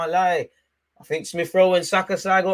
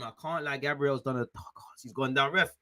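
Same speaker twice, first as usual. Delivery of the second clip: ordinary talk, nothing marked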